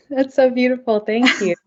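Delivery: touched laugh, then words. Real, not acted. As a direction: laugh it out, moved. Touched by a compliment.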